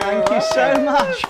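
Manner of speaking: loud, high, drawn-out gratitude; elated thanks. Real, not acted. New citizen thanking cheering hosts, elated. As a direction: soak up the cheers, thanking them loudly.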